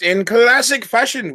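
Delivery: high, slow and loud announcement; announcer voice. Real, not acted. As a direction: draw it out like a show host. Host announcing format.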